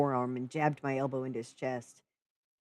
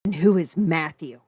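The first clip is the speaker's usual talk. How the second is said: louder, insistent question; demanding; 2 semitones higher, 10 dB louder than usual. Acted. Narrator confronting Lee about Matthew, demanding and louder. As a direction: demand the answer. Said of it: "I demanded".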